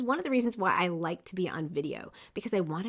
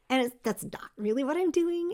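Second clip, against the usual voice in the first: ordinary talk, nothing marked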